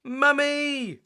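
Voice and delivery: high, loud panicked call; shouting. Acted. Peppa calling Mummy to save her snow castle, high shout. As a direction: yell for help. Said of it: "cried Peppa".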